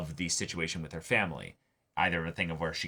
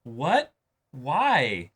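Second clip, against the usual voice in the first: higher, louder startled what and why; hammed-up offended surprise; mock shocked reaction; 5 semitones higher, 4 dB louder than usual. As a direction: act caught off guard and baffled. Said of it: "And it's just like".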